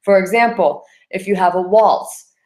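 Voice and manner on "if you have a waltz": ordinary talk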